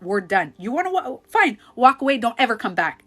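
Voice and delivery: loud angry dismissal; loud, high triggered ultimatum. Retold or imitated. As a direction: throw him out, shouting. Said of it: "Like, so triggered".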